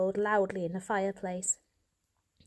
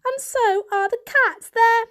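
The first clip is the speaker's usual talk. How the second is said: high and louder; insistent kitten; 7 dB louder than usual. A narrator's reading. Varjak defends his story, high insistence. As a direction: push it out eagerly.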